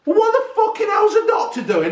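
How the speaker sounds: pitched high, disbelieving rant at the doctor; outraged. Real, not acted. Ranting at a newspaper story, outraged and high. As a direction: sound appalled at the doctor's warning.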